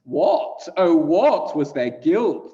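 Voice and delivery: loud, high, drawn-out questioning cry; anguished outcry. Acted. Scholars ask about their guilt, loud anguished outcry.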